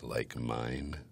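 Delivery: low, soft repeat; understated manly rumble; deep voice. Shown as a demonstration. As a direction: say it deep, almost too quiet to catch. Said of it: "it was so deep, I didn't pick it up".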